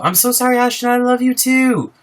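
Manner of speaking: high gushing apology to the chat. Real, not acted. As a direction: squeal an apology to a fan.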